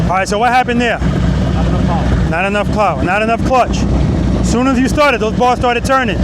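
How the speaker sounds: loud, carrying cues across the practice lot; coaching calls. Real, not acted. Riding instructor shouting cues to a student on the bike, loud. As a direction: call the clutch rhythm out to the rider.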